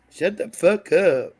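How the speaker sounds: brash Philly tough-talk impression; Philly accent. Demonstrating how Philadelphia people talk, brash Philly accent. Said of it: "That's Philly".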